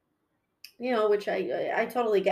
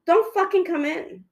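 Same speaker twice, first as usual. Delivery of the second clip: higher, louder, with "don't" punched; loud warning; 8 semitones higher, 4 dB louder than usual. Retold or imitated. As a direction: yell out "don't fucking come in". Warning customers not to come.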